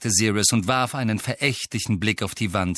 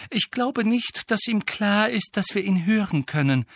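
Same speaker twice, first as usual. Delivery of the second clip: high, strained earnest voice; pleading; 13 semitones higher than usual. A narrator's reading. Girl explaining the elf to his master, pleading. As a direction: keep entreating in a lifted voice. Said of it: "flehte Hermine".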